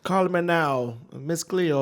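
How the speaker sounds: hammed-up Jamaican psychic hotline voice; Jamaican accent. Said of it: "wait, she's not Jamaican?".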